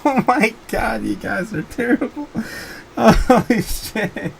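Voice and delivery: words squeezed out through laughter, slow; losing it, can barely speak for laughing; laughing helplessly. Real, not acted. Streamer cracking up at the chat's jokes, laughing through words. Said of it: "I can't get my composure back".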